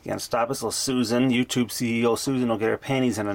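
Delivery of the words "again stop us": usual speaking voice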